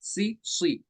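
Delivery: सी light then सी heavy; two सी, thin and full; thin versus full si. Shown as a demonstration. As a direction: contrast सी from सीन with सी from सौद. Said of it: "सी और सी में फर्क है".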